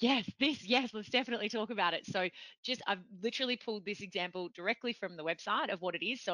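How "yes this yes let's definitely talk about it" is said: higher, eager mimicry of someone keen; imitated excitement. Host imitating guest's keenness about Springboard, excited. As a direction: act out an excited yes. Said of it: "I could hear the excitement in your voice".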